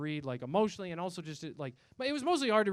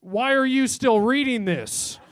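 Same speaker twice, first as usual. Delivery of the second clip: mock macho yell; 5 semitones higher, 11 dB louder, slower than usual. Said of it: "Third page".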